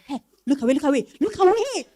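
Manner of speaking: quick, pressing whisper-like warning; urgent hushed warning. Acted. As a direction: hiss it so he can't hear.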